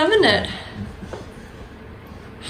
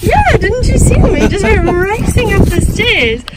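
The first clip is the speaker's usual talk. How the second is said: excited praise; 8 semitones higher, 5 dB louder than usual. Real, not acted. Praising pig climbing stairs.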